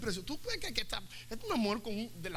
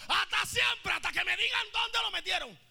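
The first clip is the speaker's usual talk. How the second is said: defiant shout; 8 semitones higher than usual. Acted. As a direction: yell it like a stubborn vow. Magdalena's vow.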